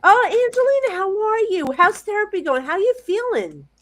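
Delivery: high, singsong delighted hello; gushing greeting. Real, not acted. Psychic asking Angelina how therapy is going, gushing. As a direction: greet someone dear with high-pitched affection.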